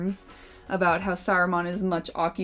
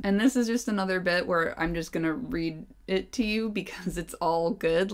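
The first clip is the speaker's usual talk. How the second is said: plain reading voice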